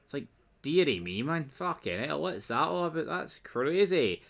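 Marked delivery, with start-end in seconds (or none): none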